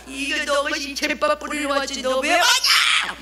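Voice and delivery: high acted rebuke rising to a yell; scold, then drag the last question into a shout; angry scolding. Grandmother guarding her ritual from the evangelist, high angry scolding. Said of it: "막, 막 호통을 치면서는".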